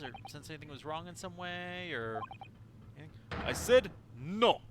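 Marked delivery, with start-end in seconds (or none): 3.5-4.6 slow, loud Russian yell; furious Russian guard; angry Russian shout. Acted. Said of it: "sorry to literally anybody who actually has a Russian accent".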